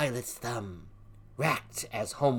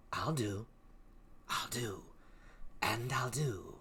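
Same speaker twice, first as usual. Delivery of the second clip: fading to a whisper; 8 dB quieter than usual. Acted. Witch's threat fading to whisper.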